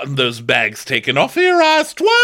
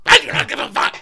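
mock tantrum; 11 semitones higher, 4 dB louder than usual. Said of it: "She's like".

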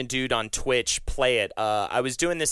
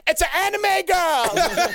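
very high, drawn-out scream imitating an overexcited fan; mock fan scream; 14 semitones higher, 4 dB louder, slower than usual. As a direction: scream it like you're losing your mind at a concert. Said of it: "just screaming like".

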